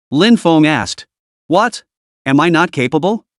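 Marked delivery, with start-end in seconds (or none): none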